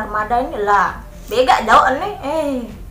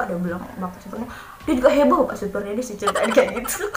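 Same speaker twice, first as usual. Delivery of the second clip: ordinary talk, nothing marked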